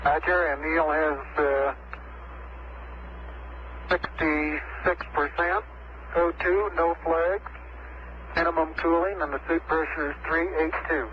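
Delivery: crackly distant radio transmission; breaking up space radio. Real, not acted. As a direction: voice coming through a weak radio link.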